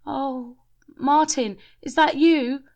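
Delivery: high, loud call; shouted. A narrator's reading. Shelley shouting to Martin's spirit, high and loud. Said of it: "shouted Shelley".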